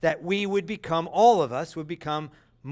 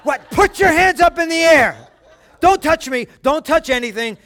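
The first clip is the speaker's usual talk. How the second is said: much higher, louder half-laughing shout; frantic dad; 9 semitones higher, 11 dB louder than usual. Retold or imitated. Father who can't find the tooth, loud comic shout. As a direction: bark orders in comic alarm. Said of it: "I said".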